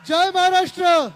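loud cry to the crowd; rally slogan shout. Real, not acted. Leader greeting the cheering crowd, loud slogan shout. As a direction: belt it out to the whole rally.

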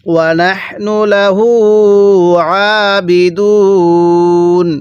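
slow, drawn-out tajweed chant; Quran recitation. Shown as a demonstration. Teacher recites the verse for students, slow tajweed chant. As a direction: intone each syllable slowly like a reciter. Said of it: "কিভাবে পড়ব?".

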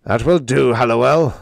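loud, stern snap; snapped. Acted. Prexy silencing hysterical Hallowell, snapping. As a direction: cut him off sharply and loudly. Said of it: "snapped McDowell".

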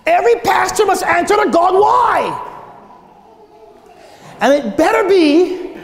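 high, forceful pulpit declaration; preaching. Real, not acted. Pastor warning every pastor of judgment, high forceful preaching. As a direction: drive the warning home, pitched high.

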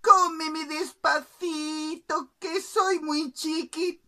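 high, loud, slow singsong; pleading little bird. Acted. Bird begs the king from the plate, high slow plea. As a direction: drag out a tiny bird's plea. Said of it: "el pajarito dijo".

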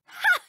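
a sharp high loud laugh bark; loud taunting ha. Acted. Game character gloating over a knocked-down rival. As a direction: throw a mocking ha at the loser.

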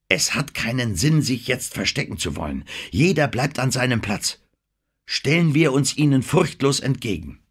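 gehobene Stimme, entschlossen; schwöre die Gruppe aufs Standhalten ein; entschlossener Appell. Acted. Denham ruft Gruppe zum Standhalten auf. (furchtloser Anführer, mit Nachdruck)

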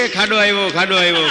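loud, high cry of a pothole ahead; shouted warning. Retold or imitated. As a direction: yell it out in mock alarm. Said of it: "તે મેં રાડ પાડી". (ખાડો આવ્યો એવી જોરથી બૂમ)

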